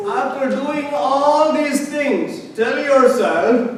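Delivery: slow, loud, high proclaiming; slow and weighty, each word set apart; solemn emphasis. Retold or imitated. Preacher proclaims Jesus' words to the congregation, slow and loud.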